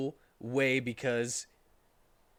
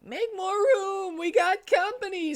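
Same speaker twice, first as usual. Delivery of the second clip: screaming Tails; 5 dB louder than usual. Acted. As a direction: stretch out a squeaky scream. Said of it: "screamed Tails".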